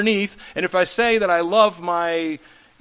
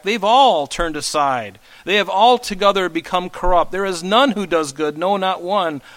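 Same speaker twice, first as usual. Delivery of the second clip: scripture reading; 4 dB louder than usual. Acted. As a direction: proclaim the verse to the room. Reads Psalm aloud.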